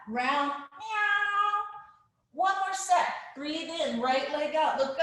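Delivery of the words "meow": long, high Halloween-cat meow; kitty cat meow. Shown as a demonstration. Teacher rounding the spine like a cat, high meowing. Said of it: "we do the kitty cat".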